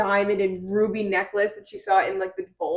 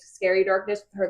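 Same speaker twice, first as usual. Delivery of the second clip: usual speaking voice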